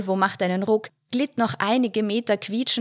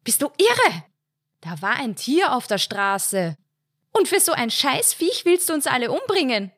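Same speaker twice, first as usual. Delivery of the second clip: angry wife; 6 semitones higher than usual. A narrator's reading. Wife furious after near crash.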